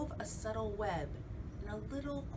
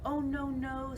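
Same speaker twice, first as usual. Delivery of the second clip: fly's voice; 7 semitones higher, 4 dB louder than usual. Acted. Fly refuses.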